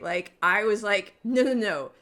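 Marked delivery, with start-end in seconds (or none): 1.2-1.9 loud, higher string of nos; retold freaked-out protest; emphatic alarmed refusal. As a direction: shut it down forcefully. Acts out freaked-out refusal to colleague.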